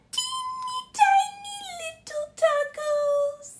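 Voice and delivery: very high, squeaky tiny voice; mouse-like squeak for tiny tacos; teeny tiny voice. Shown as a demonstration. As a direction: shrink your voice way up. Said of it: "Can you do that with me?".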